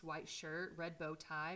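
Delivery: plain reading voice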